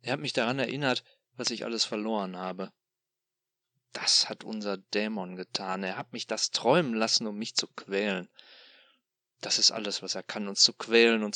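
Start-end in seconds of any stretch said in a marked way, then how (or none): none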